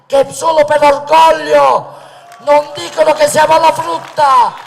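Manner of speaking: loud, high, impassioned rally oratory; booming balcony speech. Acted. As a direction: thunder it out to the crowd like a politician. Rally speech at full force.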